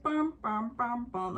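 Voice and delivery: sung horn fanfare, bum bum; horn imitation. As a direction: mimic the horns. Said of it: "like a trumpet or whatever the horns were".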